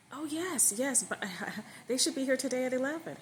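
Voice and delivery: high, bright-voiced eager reply with a laugh; forced cheerful wife. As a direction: overdo the cheer to hide her feelings.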